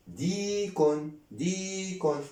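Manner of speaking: "ديكن" as dii-kun with a long ee; spelled-out reading. Shown as a demonstration. Teacher reads out the spelled word, slow long vowel. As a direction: stretch the first syllable of "ديكن". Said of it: "دي كاف ضمتان كن".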